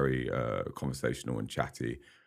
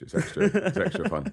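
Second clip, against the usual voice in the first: laughing; 5 dB louder than usual. Real, not acted. Laughing about the Scottish accent.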